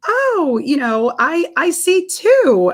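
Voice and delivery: high, drawn-out technician voice; acted ultrasound technician. Technician breaking news to the expectant mother, high-voiced imitation. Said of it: "the technician's like".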